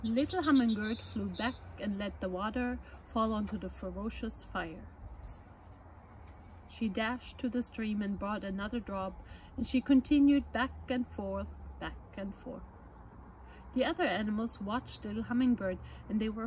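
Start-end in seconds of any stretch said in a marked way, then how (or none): none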